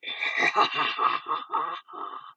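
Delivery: breathy eerie distorted cackle; a menacing unhinged laugh; altered villain laugh. Acted. Villain mocking Rick with an eerie laugh. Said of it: "he heard an altered laugh".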